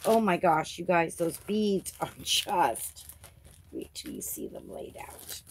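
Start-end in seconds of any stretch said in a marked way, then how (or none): 3.7-5.4 low, soft whisper; lower, softer whispered tease. Real, not acted. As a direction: hush it like a secret. Excited secret about the beads.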